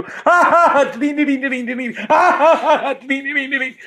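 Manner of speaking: very high mock laugh that chimes "ding, ding"; high laughing chime, half giggled; goofy ding laugh. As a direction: ham up a strange bell-like laugh. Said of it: "they go".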